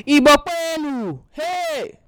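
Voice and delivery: high, loud Igbo chant shout; rallying call. Acted. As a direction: call out to your people with pride. Calls out an Igbo rallying cry.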